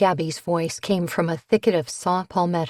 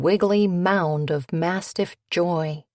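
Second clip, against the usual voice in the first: ordinary delivery, nothing marked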